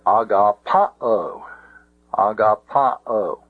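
"agapao" slowly, stressing PA. Shown as a demonstration. Teacher gives away where the accent is, "agapao" stressed on PA. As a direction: lean on the third syllable of "agapao", pa. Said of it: "giving away where the accent is".

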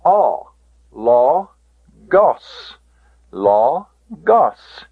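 LAW, GOS as separate emphatic syllables with "aw"; omicron sounded out. Shown as a demonstration. Teacher drills the omicron sound, LAW and GOS split apart. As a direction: sound out ALL, then LAW... GOS, twice. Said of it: "the pronunciation of the omicron is".

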